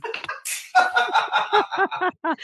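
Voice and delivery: delighted laugh at his own joke; real laughter; high burst of laughter. Real, not acted.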